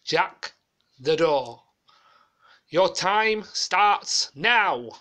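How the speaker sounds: loud, very slow drawn-out host catchphrase; hammed-up game show start signal; Leslie Grantham catchphrase. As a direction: bellow the countdown call, dragging each word. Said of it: "doing a copycat of Leslie Grantham on Fort Bayard".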